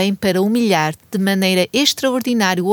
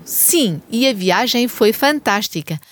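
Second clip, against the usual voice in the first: usual speaking voice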